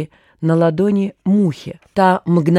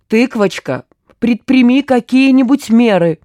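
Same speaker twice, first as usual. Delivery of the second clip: higher, louder plea for action; insistent pleading; 5 semitones higher, 5 dB louder than usual. A narrator's reading. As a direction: urge her to intervene. Gurkin pleads for help. (настойчиво просит)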